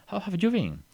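high cheerful repeat; lively variant. Shown as a demonstration. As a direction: say it again brightly. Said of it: "podemos decir".

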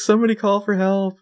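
high urgent mock call; mock panicked call. Allie calling for help on the roof, high urgent mock call. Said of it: "And Allie's like".

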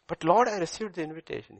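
high, loud anxious plea to the Lord; pleading protest. Acted. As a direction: protest your innocence, rising high and loud. Sinner pleading at judgment day.

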